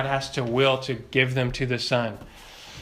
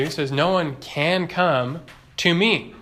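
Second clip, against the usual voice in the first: ordinary delivery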